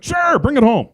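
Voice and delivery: high, loud delighted yes; ecstatic. Retold or imitated. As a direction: reenact jumping at the offer with glee. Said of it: "so ecstatic 'cause I've always wanted a dog".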